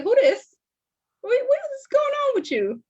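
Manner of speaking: high-pitched, puzzled check on yourself; mock concerned self-questioning. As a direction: question your reflection in mock alarm. Questions own mirror reflection.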